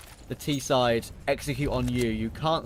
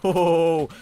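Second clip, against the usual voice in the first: excited laugh; 7 semitones higher, 10 dB louder than usual. Real, not acted. Caster reacting to close play.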